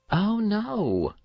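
light, high, offhand oh no; casual boy. A narrator's reading. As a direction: toss it off as if nothing. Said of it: "said the boy casually".

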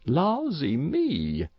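high, long-drawn country astonishment; flabbergasted country woman; astonished exclamation. A narrator's reading. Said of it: "exclaimed Missus Race, opening her mouth".

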